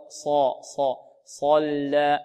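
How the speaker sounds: صا heavy, lips unrounded, very slow; correct heavy sad. Shown as a demonstration. Teacher modelling the unrounded sad very slowly. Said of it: "بدون ضم الشفتين هكذا".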